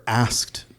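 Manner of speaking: "asked" set apart, ending on a rise; scare quotes. Real, not acted. Person of color told to code switch, "asked" in scare quotes. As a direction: put audible quote marks on "asked". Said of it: "scare quotes around that".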